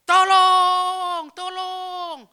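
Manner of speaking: screaming high and loud for help; desperate scream for help. Retold or imitated. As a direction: cry out for your life. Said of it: "saya langsung berteriak".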